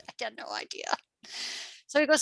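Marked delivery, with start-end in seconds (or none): 0.1-0.9 whispered in wonder; breathless whispered disbelief. Retold or imitated. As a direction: hush it, amazed. Amazed she qualified.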